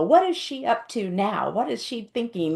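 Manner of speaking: mock distrustful second-guessing; suspicious. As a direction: wonder warily what she's plotting. Imagined reaction to someone mistrusted.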